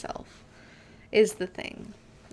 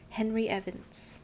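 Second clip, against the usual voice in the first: usual speaking voice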